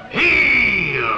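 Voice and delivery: a sharp shouted "Heel." like ordering a dog; shouted command. Acted. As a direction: bark the order down the phone at the dog. Commanding the dog over phone.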